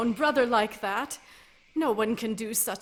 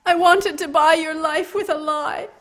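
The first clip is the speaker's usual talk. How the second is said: louder, breaking confession; anguished outburst; 4 semitones higher, 10 dB louder than usual. Acted. Lady confessing her lie to Riker, anguished outburst. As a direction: burst out in despair.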